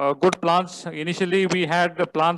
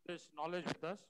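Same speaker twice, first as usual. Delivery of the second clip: faint, distant voice; speaking too softly into the mic; barely audible; 20 dB quieter than usual. Real, not acted. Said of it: "Soft again".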